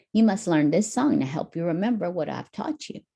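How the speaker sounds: ordinary delivery, nothing marked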